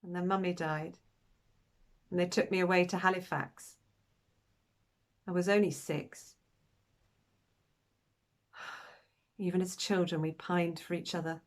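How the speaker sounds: subdued sadness. Acted. Recalling mother's death and separation.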